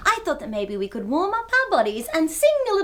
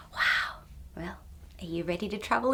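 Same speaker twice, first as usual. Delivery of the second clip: whispering; 8 semitones lower, 10 dB quieter than usual. Acted. Whispered wow.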